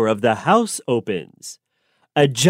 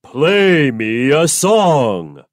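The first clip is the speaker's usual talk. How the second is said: giant; much slower than usual. Acted. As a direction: sniff out the boy, slow and booming. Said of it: "says the giant".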